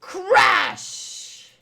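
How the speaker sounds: loud shouted crash; crash sound effect. Acted. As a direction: bellow the impact noise.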